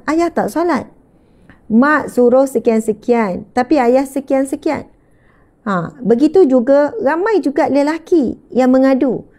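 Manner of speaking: ordinary talk, nothing marked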